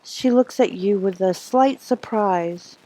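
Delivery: ordinary talk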